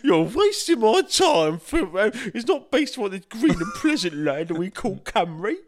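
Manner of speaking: hammed-up Cockney grumble. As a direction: drag it out like an indignant Cockney. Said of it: "that Cockney voice for Camry".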